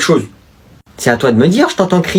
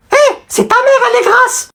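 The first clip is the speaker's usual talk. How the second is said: put-on squeaky kid voice. Sidekick points out the other class in a squeaky voice. Same put-on character insulting.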